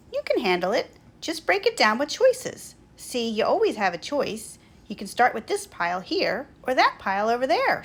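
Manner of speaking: lightly attempted Australian accent, loud. A narrator's reading. Schubert coaching Big Benny through choices, Australian accent. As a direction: reassure with a touch of Australian accent. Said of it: "replies in an Australian accent".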